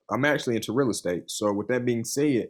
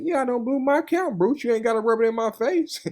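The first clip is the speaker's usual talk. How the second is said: mock pleading whine, pitched well up; retelling his own fed-up plea, pitched well up; high exasperated whine; 12 semitones higher than usual. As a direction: whine it up high like a sore loser. Retold exasperated reply to Bruce.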